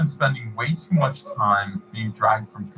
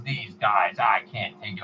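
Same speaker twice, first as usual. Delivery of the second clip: lightly voiced grumbling celebrity; mild imitation of a fed-up signer; Stan Lee impression; slower than usual. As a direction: grumble as if worn down. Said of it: "compliment on my impression".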